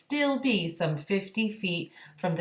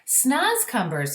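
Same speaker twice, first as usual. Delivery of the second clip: higher, louder, slow proud cry; BFG crying out; 8 semitones higher, 4 dB louder, slower than usual. A narrator's reading. The BFG announcing the sack's contents to the Queen, slow and loud. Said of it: "he cried".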